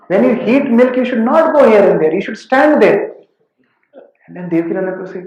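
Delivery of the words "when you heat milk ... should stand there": raised, scolding retelling of the guru. Retold or imitated. Guru scolding a careless disciple over the milk, raised voice. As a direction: scold him loudly for wandering off.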